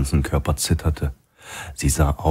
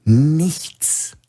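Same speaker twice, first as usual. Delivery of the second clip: hissed repeat; 11 semitones higher than usual. A narrator's reading. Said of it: "fauchte der Filialleiter".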